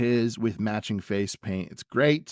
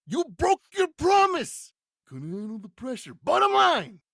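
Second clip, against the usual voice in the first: Macho Man. Acted. Wrestler accusing opponent of breaking a promise, hammed-up yelling. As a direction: hurl each accusation in a high promo shout. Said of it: "At which point he says".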